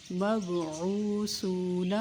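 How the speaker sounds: slow مبعوثون with the long vowels held; stretched long vowels. Shown as a demonstration. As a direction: pull مبعوثون out, each long vowel one alif. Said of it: "ایک الف کے برابر کھینچ کر". (مبعوثون آہستہ، حروف مدہ کھینچ کر)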